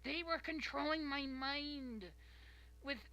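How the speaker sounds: over-the-top mock mother insisting it was them; mocking excuse voice. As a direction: whine like a guilty mom blaming everyone else. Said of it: "Like, some".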